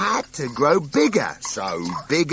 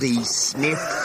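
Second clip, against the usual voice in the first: usual speaking voice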